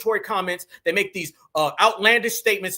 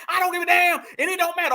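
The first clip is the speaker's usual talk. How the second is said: mock hollering; 9 semitones higher, faster than usual. Host mimics the woman's cussing at police, mock hollering. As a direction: holler it out, mocking the ranting. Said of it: "all that cussing and hooting and hollering".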